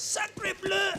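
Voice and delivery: high, hammed-up French exclamation; French accent. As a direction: overblown French cry. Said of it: "I don't wanna hear Kenny as".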